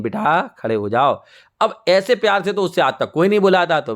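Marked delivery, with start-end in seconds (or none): 0.0-1.2 slow, lower, tender coaxing; very loving. Acted. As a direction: gently coax a scared child, unhurried. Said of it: "बहुत प्यार से बोलती हैं".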